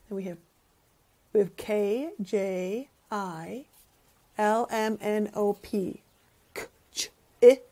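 ordinary delivery

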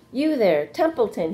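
calling out. A narrator's reading. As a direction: call the rat out from his hiding place. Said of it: "he called".

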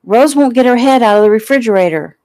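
put-on high, loud child complaining; tattling little girl. Heather tells her mother about her sister, loud high child's whine. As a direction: tell on your sister like a small girl. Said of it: "Heather cried".

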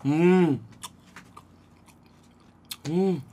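humming while chewing food; muffled closed-mouth murmur; mouth full chewing. Real, not acted. Seen 3: Cheeks full, lips closed, chewing after biting dumpling held in hand.

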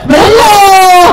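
barking gym coach. Acted. Rejects weak cheer, demands again.

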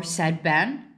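ordinary delivery

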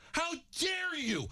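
high, slow outraged shout; furious shout. Real, not acted. Host shouting down the lineman who insulted fans, furious shout. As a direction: hurl it at him. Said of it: "that angers me".

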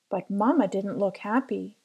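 ordinary delivery, nothing marked

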